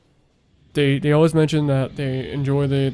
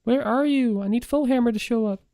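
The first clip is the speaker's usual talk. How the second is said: higher singsong call; mock calling out; 10 semitones higher than usual. Player to the game, wanting Foehammer to show up, mock calling out. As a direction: call out high for someone missing.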